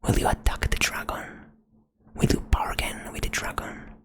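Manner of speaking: ordinary talk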